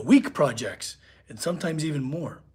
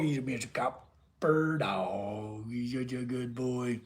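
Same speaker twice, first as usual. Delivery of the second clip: low, slow, much softer murmur to the dog; cooing to dog; 5 semitones lower, 14 dB quieter, slower than usual. Real, not acted.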